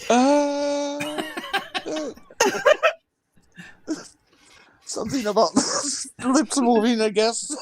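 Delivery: muffled behind her hand; hand over mouth. Pretend. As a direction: talk through covered mouth. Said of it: "put your hands over your mouth and say it like that".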